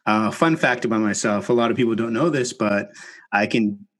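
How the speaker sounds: normal talking voice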